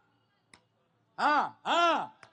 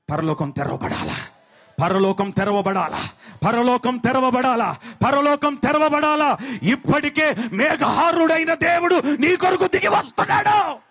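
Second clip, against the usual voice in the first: building preaching shout; 3 semitones higher, 12 dB louder than usual. Acted. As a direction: climb each repeat into a roaring proclamation. Repeated call rising to shout. (తక్కువ స్వరం నుండి బిగ్గర అరుపు వరకు ఎదిగే ప్రసంగం)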